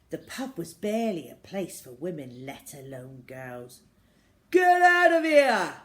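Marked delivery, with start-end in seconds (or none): 4.5-5.7 bellowed, high and loud; angry landlord yelling at a girl; furious shout. A narrator's reading. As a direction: roar him out of the pub. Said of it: "shouted the ruddy-faced landlord".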